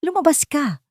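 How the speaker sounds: high, sharp order to get out; old woman barking a command; commanding shout. A narrator's reading. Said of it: "utos ni Doña Lilia". (galit na utos)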